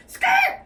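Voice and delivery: high screeching skid noise; imitated tire screech. Imitating the car skidding in, high screech. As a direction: squeal like car tires braking. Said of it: "she goes".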